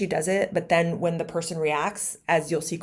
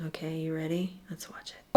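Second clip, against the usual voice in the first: whisper; 5 dB quieter than usual. Real, not acted. Hushed setup before playing clip.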